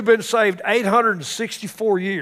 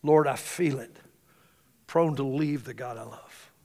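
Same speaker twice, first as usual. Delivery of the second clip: hymn line quoted; 4 semitones lower, 6 dB quieter than usual. Acted. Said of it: "that famous line that says".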